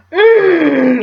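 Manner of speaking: a long loud high hum of pleasure; loud appreciative mm. Real, not acted. Vlogger sniffing her perfume for viewers, delighted hum. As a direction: savour the scent out loud.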